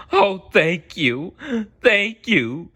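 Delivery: high, loud, slow tearful gush; sobbing thanks. Acted. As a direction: blubber out your gratitude. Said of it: "he sobbed all the louder".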